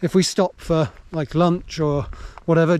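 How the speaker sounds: normal talking voice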